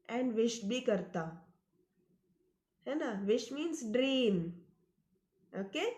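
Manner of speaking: ordinary delivery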